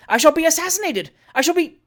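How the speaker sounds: high, loud terrified wail; panicked cry. Acted. Trott fearing murder in the chaise, terrified cry. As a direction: cry out in terror, cut off.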